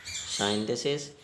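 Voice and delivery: usual speaking voice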